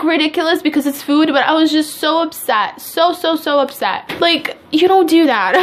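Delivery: usual speaking voice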